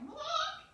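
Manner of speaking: high, loud squawk; sudden piercing bird noise; imitated rooster squawk. Shown as a demonstration. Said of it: "it sounded like".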